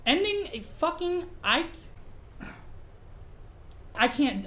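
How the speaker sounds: usual speaking voice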